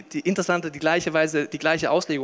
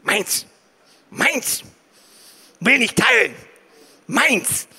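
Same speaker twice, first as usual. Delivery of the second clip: put-on toddler voice; 4 semitones higher, much slower than usual. Toddler in the mine phase.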